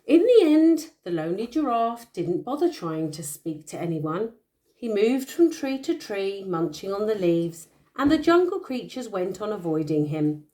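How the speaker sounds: bedtime storytelling voice; story narration. A narrator's reading. Parent reading a picture book to a child, storytelling. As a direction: read the tale aloud to a little one.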